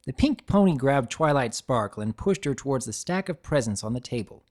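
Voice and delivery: usual speaking voice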